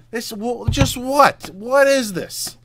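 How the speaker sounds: high-pitched, bewildered outcry; stunned disbelief. Real, not acted. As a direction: squeak it out, utterly incredulous. Stunned after losing shot.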